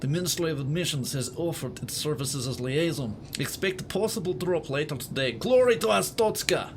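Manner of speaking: put-on Russian accent, firm ending. Shown as a demonstration. Gamer reading a ministry bulletin, mock Russian accent. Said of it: "get the accent a bit more Russian".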